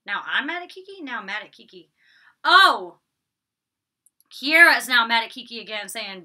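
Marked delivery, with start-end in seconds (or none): 0.1-1.0 high-pitched baffled echo; puzzled questioning. Real, not acted. Vlogger doubting the notes just read out, puzzled. 2.4-3.0 loud, higher-pitched exclamation; sudden realization. Real, not acted. Vlogger finally working out the misread note, sudden realization.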